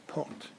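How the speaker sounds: port, with the final O of Porto dropped. Shown as a demonstration. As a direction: port said short, no O at the end. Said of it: "they drop the O".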